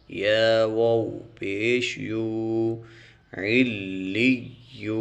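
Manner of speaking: slow, measured tajweed recitation; Quran recitation. Shown as a demonstration. Teacher spelling Quran syllables for students, slow chant. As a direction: chant each syllable slowly and clearly.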